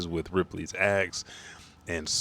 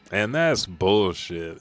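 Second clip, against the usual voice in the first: normal talking voice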